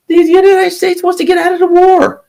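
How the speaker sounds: sudden very high squeaky voice; high-pitched mocking aside; mock squeak. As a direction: squeak it out in mockery.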